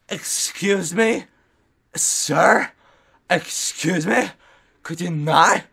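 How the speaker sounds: high, loud, drawn-out mock politeness; indignant complaint. Complaining to the noise talking over them, mock indignant. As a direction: call out affronted, stretching each request.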